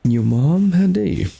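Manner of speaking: hammed-up affronted protest; mock outrage. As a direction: act scandalised, pitch leaping up.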